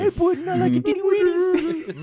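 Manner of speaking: put-on high loud baby-talk voice. Shown as a demonstration.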